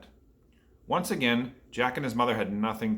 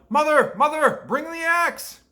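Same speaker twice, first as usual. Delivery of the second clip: much higher, loud desperate yell; Jack yelling; 14 semitones higher, 7 dB louder than usual. A narrator's reading. Jack yelling to his mother from the beanstalk. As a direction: yell down for help in a panic.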